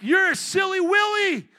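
high, loud hammed-up insult; mock angry shout. Preacher showing his harmless cuss words, mock angry shout. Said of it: "I've used that in anger before".

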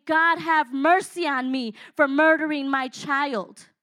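slow, loud, anguished plea to God; loud cry for mercy. Retold or imitated. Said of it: "It was a cry of saying".

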